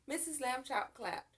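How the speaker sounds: ordinary delivery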